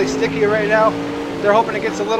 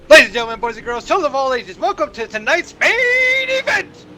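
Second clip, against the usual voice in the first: ringmaster; 6 semitones higher than usual. Pretend. Circus-style race intro.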